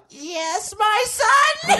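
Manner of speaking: very high comic God voice; unexpected God. Acted. God answers a prayer, absurdly high voice. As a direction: squeak it out as God. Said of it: "what if God didn't sound like".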